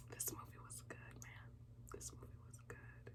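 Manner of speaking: very faint fading whisper. Real, not acted. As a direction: let it fade to a whisper. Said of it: "I feel like a proud sister".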